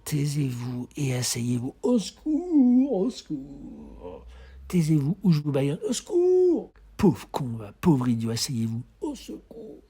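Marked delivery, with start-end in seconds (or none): 1.8-4.2 slow, high mock wail fading down. Slow wailing call. 5.9-6.7 much higher, louder call; shrill mock cry. 9.0-9.8 high, softer breathy mock plea; whispered cry.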